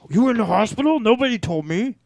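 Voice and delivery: high, loud mock indignation; mock outraged friend. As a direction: whine it like a wronged friend. Imitating angry friend.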